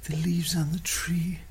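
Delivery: hippie whisper. Acted. Hippie character whispered line.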